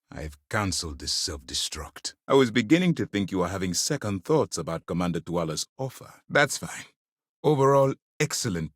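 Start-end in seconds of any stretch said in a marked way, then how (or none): none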